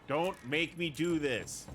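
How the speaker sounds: high, loud frantic begging; panicked pleading. Real, not acted. Frantic quick-time event.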